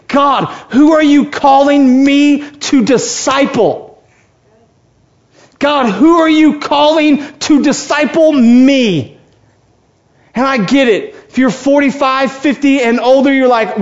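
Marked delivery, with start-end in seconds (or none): 0.1-9.2 slow, loud and high, crying out to God; preacher shouting a prayer for the congregation; fervent shouted prayer. Acted. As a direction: call out to God from the pulpit, drawing it out.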